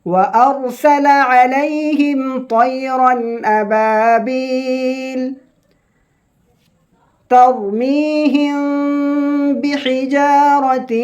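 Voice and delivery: slow, high melodic chanting of the verse; Quran recitation. Shown as a demonstration. Teacher opening the Surah lesson with slow high recitation.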